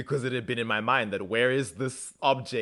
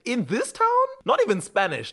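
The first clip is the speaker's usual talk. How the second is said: high-pitched scoffing question; lively, exaggerated disbelief; incredulous; 11 semitones higher, 4 dB louder than usual. Speaker doubts Matt takes Italian in a small town, incredulous.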